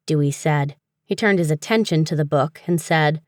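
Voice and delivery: ordinary delivery, nothing marked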